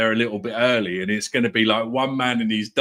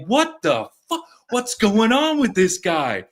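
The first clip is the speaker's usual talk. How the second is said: confused outburst; 8 semitones higher, slower than usual. Retold or imitated. Confused coworkers' reaction.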